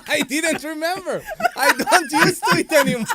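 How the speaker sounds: high, loud voice through laughter; squealed, laughing self-defence; laughing protest. Real, not acted. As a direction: protest loudly while cracking up. Laughing defence while being teased.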